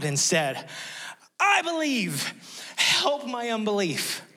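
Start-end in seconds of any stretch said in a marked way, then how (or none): 1.4-4.2 much higher, pleading cry of a desperate father. Retold or imitated. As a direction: cry it up high like a father at the end of his rope. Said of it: "the father of the child cried out".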